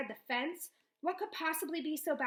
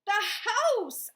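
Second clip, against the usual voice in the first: much higher and louder; angry yell; 10 semitones higher, 10 dB louder than usual. A narrator's reading. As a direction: yell it at her. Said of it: "I yell at her".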